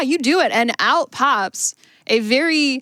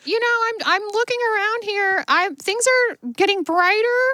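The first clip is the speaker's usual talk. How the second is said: pitched well up, light and relieved; high voice of the freed stranger; high rescued-man voice; 8 semitones higher than usual. Acted. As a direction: keep the lost man's high voice going. The rescued man keeps talking.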